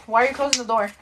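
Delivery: ordinary delivery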